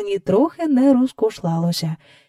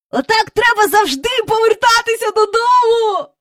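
put-on high-pitched excited child shrieks; twins squealing; 14 semitones higher, 4 dB louder than usual. A narrator's reading. Twins spinning and bouncing in the air, squealing. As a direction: squeal it like delighted little children. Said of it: "Верещали близнята".

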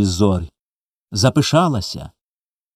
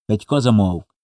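ordinary delivery

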